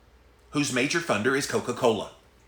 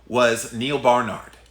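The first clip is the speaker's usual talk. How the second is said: normal talking voice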